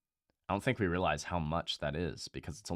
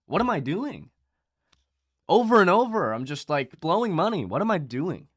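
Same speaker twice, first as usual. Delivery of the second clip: higher, louder, exasperated questioning; frustrated self-scolding; 8 semitones higher, 6 dB louder than usual. Retold or imitated. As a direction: berate yourself in the mirror. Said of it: "and I'm like".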